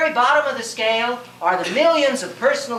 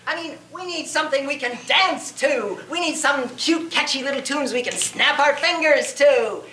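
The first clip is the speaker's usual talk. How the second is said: philistine critic; 3 semitones higher than usual. Acted. Critic turning on Beethoven over his Ninth Symphony, mock-apologetic. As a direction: play a theatrical, condescending critic.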